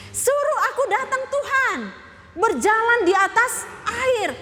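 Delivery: high, laughing amazement at walking on water; laughing wonder. Acted. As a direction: laugh through the request in disbelief. Marvelling at Peter's request.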